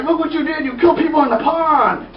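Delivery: high-pitched, overdramatic accusation; mock outraged cry. Man accuses killer at pond, mock outrage. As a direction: wail it in put-on outrage.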